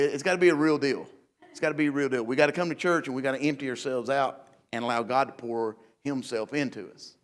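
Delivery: normal talking voice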